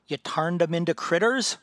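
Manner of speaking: can barely believe it, blurt it out; amazed. Acted. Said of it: "can barely contain his amazement".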